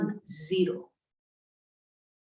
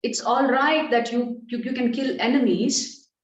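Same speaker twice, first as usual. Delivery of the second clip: louder, insistent objection as Arjuna; impassioned protest; 2 semitones higher, 6 dB louder than usual. Acted. Arjuna objects to killing his teacher and grandfather, impassioned protest. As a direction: plead the case forcefully, voice raised.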